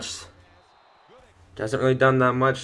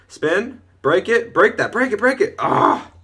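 higher, louder, rising in urgency; urgent egging-on; 9 semitones higher, 5 dB louder than usual. Real, not acted. Gamer willing his runner to break free, urgent egging-on. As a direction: urge the runner on, pitch climbing with each call. Urging on the ball carrier.

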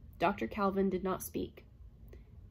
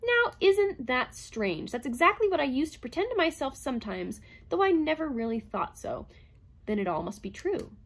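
higher, brighter, elated; girlish vivacity; 6 semitones higher, 4 dB louder than usual. A narrator's reading. Calvin delighted by the robot's news, girlish and elated. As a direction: bubble over with joy. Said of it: "vivacity almost girlish".

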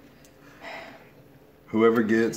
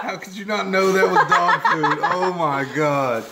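laughing while talking; words through real laughter; 9 semitones higher, 4 dB louder, slower than usual. Real, not acted. Laughing at friend eating dog food.

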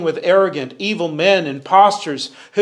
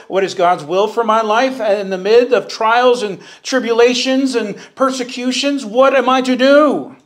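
preaching at full force; 7 semitones higher, 5 dB louder than usual. Acted. Rising preached questions.

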